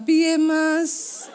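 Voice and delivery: slow, sweet exaggerated Javanese wife voice; put-on polite Javanese. Wife waking husband in mock-polite Javanese. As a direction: drawl it out like a dainty Javanese wife. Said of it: "aku koyo wong Jawa".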